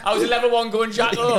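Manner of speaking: high, loud, laughing jibe; incredulous teasing. Retold or imitated. Player teasing coach over his badge, incredulous. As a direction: call it out in amused disbelief. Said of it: "I was like".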